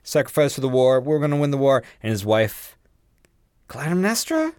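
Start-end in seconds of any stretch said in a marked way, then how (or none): 0.0-1.8 mock king's announcement.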